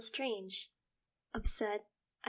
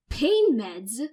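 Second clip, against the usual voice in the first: shriek of disbelief; 3 semitones higher, 14 dB louder than usual. Acted. Son shrieking at his mother, disbelieving. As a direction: shriek the words back at her. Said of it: "I shriek in disbelief".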